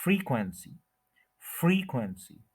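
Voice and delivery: Frequency with stretched FREE, falling after; stress on first syllable. Shown as a demonstration. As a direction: give the first syllable of frequency its full time. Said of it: "पूरा time देना है".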